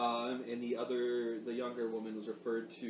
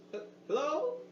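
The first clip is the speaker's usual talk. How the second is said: high, hesitant hello; frightened old woman's call; nervous hello. Acted. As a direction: answer warily through the door. Frightened grandmother behind door.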